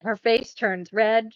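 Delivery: ordinary talk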